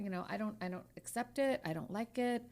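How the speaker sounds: ordinary talk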